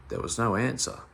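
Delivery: usual speaking voice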